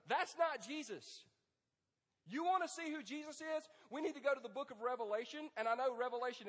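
ordinary talk

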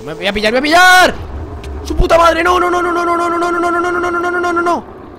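building to a very high scream; real high, loud, frantic yelling; panicked screaming. Real, not acted. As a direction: scream in rising panic as you're caught. Caught and attacked in game.

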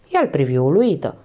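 ordinary delivery

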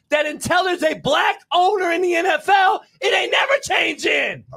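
exasperated, rising in pitch; heated challenge. Real, not acted. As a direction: challenge them, pitch climbing to a peak. Heated argument, challenging co-host.

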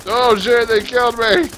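high loud yell of alarm. Real, not acted. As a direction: shout as you go down. Killed in game.

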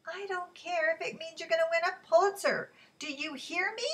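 high, loud and quick; angry demand. Acted. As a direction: force her to listen. Quarrel in a restaurant.

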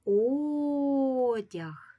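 "Одяг" with a long, high, loud О. Shown as a demonstration. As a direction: stretch and lift the first syllable of "Одяг". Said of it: "який склад у ньому вимовляється гучніше".

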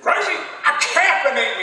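high, loud comic customer; funny voice. Retold or imitated. Friend answering the server about caffeine, funny voice. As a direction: blurt it in a put-on high voice. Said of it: "Do it in a funny voice".

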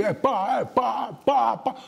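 high volley of vocal bangs; imitated gunfire. Boasting hunter killing ducks nonstop, imitated gunshots. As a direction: fire off shot after shot with your voice.

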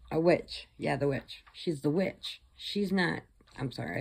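normal talking voice

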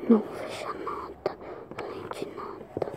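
whispered under the breath; hushed whisper to self. Real, not acted. Same words repeated in whisper.

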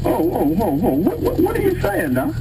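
high, stunned, whoa then what what; a husband suddenly alarmed, voice jumping up; shocked disbelief. Pretend. Husband hearing the doctor's hint, shocked and high.

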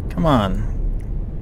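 ordinary talk, nothing marked

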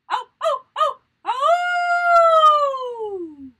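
high, loud howl together; wolf howl. Shown as a demonstration. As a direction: howl along with the class. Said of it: "try again. With me".

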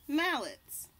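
ordinary delivery, nothing marked

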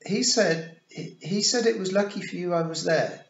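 high, loud stumbling confession; flustered boy. A narrator's reading. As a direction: blurt it out awkwardly.

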